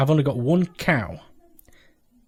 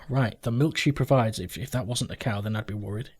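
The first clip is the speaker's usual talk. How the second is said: ordinary talk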